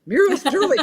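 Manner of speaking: high, loud disbelieving question; shocked she was killed off. Retold or imitated. Author reads that her character is dead, shocked. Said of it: "I'm going".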